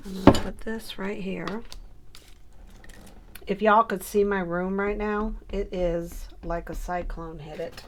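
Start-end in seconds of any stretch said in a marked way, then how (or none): none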